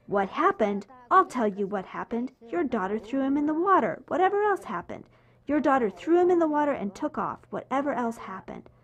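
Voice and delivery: a father berating the mother over his wet son; angry accusation. Retold or imitated. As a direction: let the outrage climb as you accuse.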